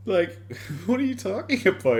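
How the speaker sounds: normal talking voice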